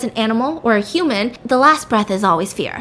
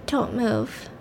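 quiet mock-urgent warning; hushed warning; 8 dB quieter than usual. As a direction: drop the volume to warn someone of danger. Frightened child warns of gunman.

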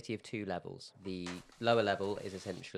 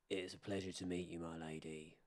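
courteous dwarf; 3 semitones lower, 10 dB quieter than usual. Acted. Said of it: "he will reply".

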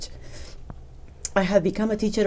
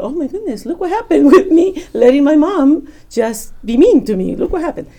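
much higher and louder, laughing amazement; amazed joy; 8 semitones higher, 9 dB louder than usual. Retold or imitated. Daughter marveling at mom's conversion, high and laughing. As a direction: burst out in laughing wonder.

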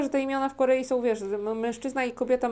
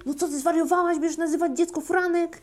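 higher-pitched grandmotherly scolding; mock scandalized grandmother; 6 semitones higher than usual. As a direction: play a grandma appalled by the choice. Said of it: "to wtedy ta babcia powie". (udawane oburzenie babci, wyższym głosem)

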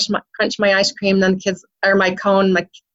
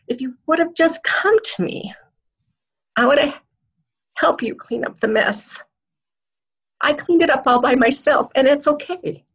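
higher, tearful voice, near crying; choked up with real emotion; 5 semitones higher than usual. Real, not acted. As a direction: fight back tears as you pass on a father's gentle words. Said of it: "sorry, this is the emotional part".

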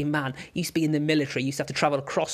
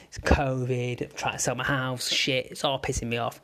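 ordinary talk

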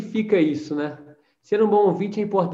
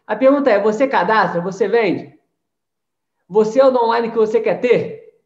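challenging; 3 semitones higher, 6 dB louder than usual. Acted. Challenging the audience.

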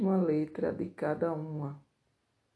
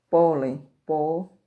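"pólen" loud on its first syllable, then "pó" alone; teacher isolating loud "pó"; stressed syllable demo; 8 dB louder, slower than usual. Shown as a demonstration. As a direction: punch out "pó" from "pólen". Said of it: "a sílaba tônica das palavras".

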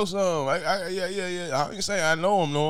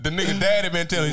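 normal talking voice

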